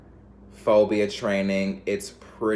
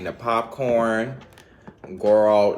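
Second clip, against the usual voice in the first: normal talking voice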